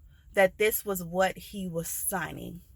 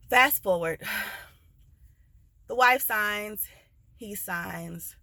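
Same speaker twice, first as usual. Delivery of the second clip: normal talking voice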